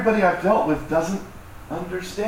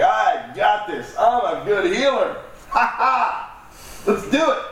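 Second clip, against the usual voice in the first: cocky disciples; 4 semitones higher, slower than usual. Retold or imitated. Disciples voiced as overconfident healers.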